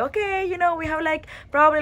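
high, fast, excited relief; relieved excitement. Retold or imitated. Travellers past security with time to spare, high fast excitement. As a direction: rattle it off high and quick, thrilled. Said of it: "We were like".

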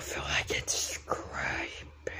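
spooky hushed whisper; creepy whisper. Acted. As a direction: whisper it like a ghost story. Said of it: "Doing that voice".

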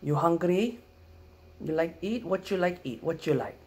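high, loud broken-English offer; Filipino grandma accent. Retold or imitated. Grandma offering food in the morning, in a Filipino accent. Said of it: "first thing ... we wake up".

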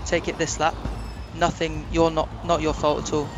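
ordinary delivery

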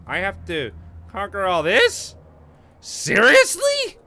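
high, loud, drawn-out incredulity; loud disbelief. Real, not acted. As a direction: stretch out the words in stunned, rising astonishment. Stunned by the huge game map.

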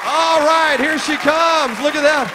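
high, loud, stretched cheer; excited hype. Real, not acted. Warm-up man as a contestant runs down, loud excited hype. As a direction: whoop the contestant along.

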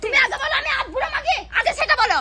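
indignant, high-pitched demand; angry shouting. Acted. Stung by an insult in a family row, angry shouting. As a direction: shout it back as an outraged challenge.

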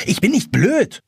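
high, indignant retort; brusque. A narrator's reading. Jonas refusing to be treated as stupid, brusque. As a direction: retort sharply, offended. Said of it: "erwidert Jonas barsch".

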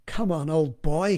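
slow, hint of an English accent; muttered English accent. A narrator's reading. Man coaxing himself downstairs, slow mock-English accent. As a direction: lightly put on English, drawn out. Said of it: "muttered in his best English accent".

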